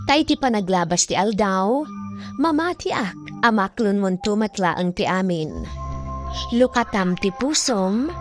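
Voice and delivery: ordinary talk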